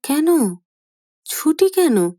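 mother's high, startled question; startled mother asking why; surprised. A narrator's reading. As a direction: ask it taken aback, voice jumping up. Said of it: "Trinayani অবাক হয়ে বলেন".

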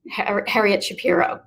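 normal talking voice

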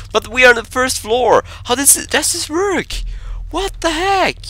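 high-pitched, incredulous protest; baffled. Real, not acted. Player baffled how the first floor works, incredulous. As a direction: pitch it way up in disbelief. Game makes no sense.

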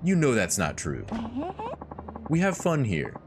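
high, hurt protest; protesting Yuki. Acted.

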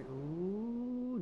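long rising high "ooh"; drawn-out wail of unease; alarmed "ooh". Real, not acted. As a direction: let an uneasy "ooh" slide upward. Startled by something in the game.

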